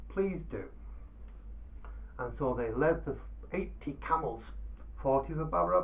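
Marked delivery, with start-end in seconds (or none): 0.1-0.6 higher, louder mock-humble holy man; Dervish's reply. A narrator's reading. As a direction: play the sly modest Dervish. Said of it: "And the Dervish said".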